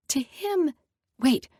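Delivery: usual speaking voice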